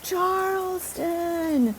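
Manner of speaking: husband yelling the city name into the phone, imitated; retold exasperated yell. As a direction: drag the word out high like a frustrated husband. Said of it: "him screaming into the phone".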